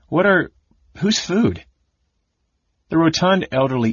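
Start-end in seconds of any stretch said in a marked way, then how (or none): 0.1-1.6 high, broken-off questions; astonished. A narrator's reading. Wife sees innkeeper holding a plate, astonished. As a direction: stumble from one surprised question to another. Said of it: "she said in astonishment".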